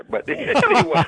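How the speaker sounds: high, drawn out through laughter; real laughing. Real, not acted. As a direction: break up laughing while trying to move on. Teasing remark, breaks into laughter.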